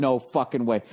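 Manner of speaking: low, awed disbelief; retold disbelief. Bystander watching a skater wobble downhill, low retold disbelief. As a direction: say it way down low, stunned. Retelling his own stunned reaction.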